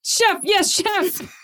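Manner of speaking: high shouted yes chef; high shouted recruit reply; shouted drill reply. Acted. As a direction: snap it out like a recruit. Hopscotch shouts drill response.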